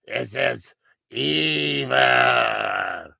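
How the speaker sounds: slow, disguised voice-changer voice. Retold or imitated. Killer calls his victim, speaking in a slow voice-changer voice. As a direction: stretch each word out like a distorted phone killer. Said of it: "And he had a voice changer".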